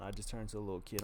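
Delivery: ordinary delivery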